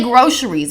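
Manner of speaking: "groceries" said GRO-sher-eez. Shown as a demonstration. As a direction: put a "sh" in the middle of "groceries". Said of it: "I was saying this shit wrong".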